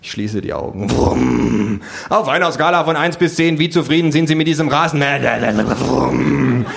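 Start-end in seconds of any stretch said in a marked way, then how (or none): 0.9-1.8 short revving engine imitation; mouthed engine noise; lawnmower roar. A narrator's reading. 2.1-5.2 raised, urgent survey voice; high-pitched frantic interviewer; doomed pollster. A narrator's reading. Pollsters survey while being mown down. 5.8-6.6 short revving engine imitation; mouthed engine noise; lawnmower roar. A narrator's reading.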